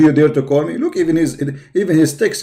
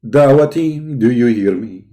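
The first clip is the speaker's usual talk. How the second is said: ordinary talk, nothing marked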